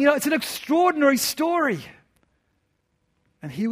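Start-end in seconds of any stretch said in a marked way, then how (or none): 0.0-1.8 high, loud host exclamation; gushing host. Retold or imitated. Host to auditorium about young man's testimony, gushing. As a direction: proclaim it like an impressed stage host. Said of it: "there was this host saying".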